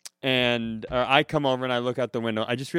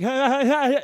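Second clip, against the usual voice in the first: high, loud bursts of mock laughter; uncontrollable laughter imitation; 11 semitones higher, 5 dB louder, faster than usual. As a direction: imitate someone who can't shut the laugh down. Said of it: "it's this deep".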